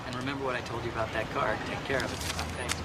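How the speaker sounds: softly spoken parting reminder; hushed farewell. Acted. Low farewell to departing customer.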